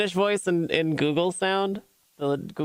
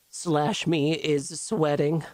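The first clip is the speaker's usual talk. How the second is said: ordinary talk, nothing marked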